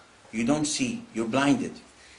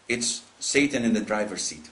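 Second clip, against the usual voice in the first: normal talking voice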